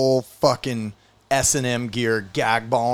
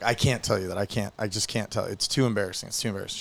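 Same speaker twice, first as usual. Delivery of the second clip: acted embarrassed, dropping softer; embarrassed refusal; 3 semitones lower, 7 dB quieter than usual. Man too embarrassed to tell a woman at the bar, quiet refusal. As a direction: quietly beg off, voice trailing away.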